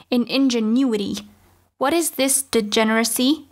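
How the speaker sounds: usual speaking voice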